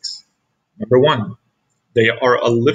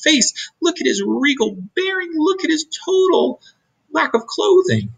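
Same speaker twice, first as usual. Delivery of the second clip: demanding mole rats; 12 semitones higher than usual. Acted. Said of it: "they demanded".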